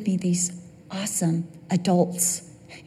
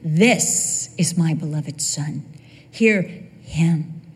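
low, slowing, weighty declaration; lightly acted voice of God, low and deliberate; God, proud father; 7 semitones lower, slower than usual. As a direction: announce a beloved son with deep pride. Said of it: "he says to Peter".

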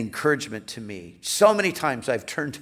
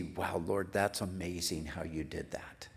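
hushed, much softer aside to God; quiet awe; 6 semitones lower, 11 dB quieter than usual. Acted. Believer looking back at what the Lord did, quiet awe. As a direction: drop to a near-whisper in wonder.

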